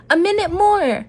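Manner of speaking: mild storybook shout. A narrator's reading. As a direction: shout back from the window, mildly. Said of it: "Mouse shouted".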